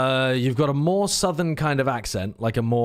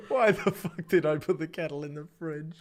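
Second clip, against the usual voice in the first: laughing; 3 semitones higher, 4 dB quieter than usual. Real, not acted. Laughing at own blunder.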